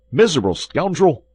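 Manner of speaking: D'Artagnan explodes at the innkeeper; angry cry. Acted. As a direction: hurl the insult at the host. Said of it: "cried D'Artagnan".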